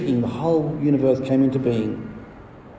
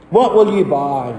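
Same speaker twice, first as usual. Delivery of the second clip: lightly called sales challenge; merchant calling out. Acted. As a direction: put it to the customer like a hawker. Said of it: "That was called out by the merchant".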